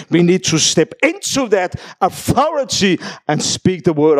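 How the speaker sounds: slow, earnest sermon voice; preaching. Acted. Pastor urges the congregation, slow and earnest. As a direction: lay it on the church steadily.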